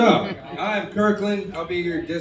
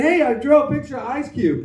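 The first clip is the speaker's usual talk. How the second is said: higher, a hint of a proud little kid; four-year-old kid; 7 semitones higher than usual. Comic imitating a four-year-old proud of a drawing, lightly childlike. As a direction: play a small child showing off a drawing. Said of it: "your four-year-old brought you a picture and said".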